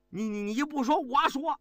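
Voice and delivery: stammering fury climbing high on 我说; angry outburst. A narrator's reading. Furious officer defying the silent general, angry shout. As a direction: stamp your foot and blurt it out. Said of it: "狱警气得一跺脚喊道".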